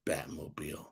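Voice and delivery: whispered under the breath; low whispered mutter; whispered aside. Real, not acted. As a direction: hiss it to yourself. Muttered aside after a curse.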